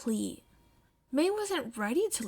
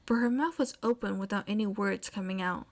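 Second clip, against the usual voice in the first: usual speaking voice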